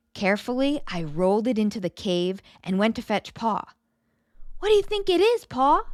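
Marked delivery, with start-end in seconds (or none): 4.6-5.8 put-on girl's question to Pa. Girl asks Pa about egg.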